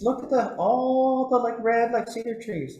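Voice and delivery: high, insistent elder voice. Retold or imitated. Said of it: "another elder".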